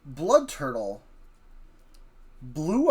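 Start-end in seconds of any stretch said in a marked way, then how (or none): none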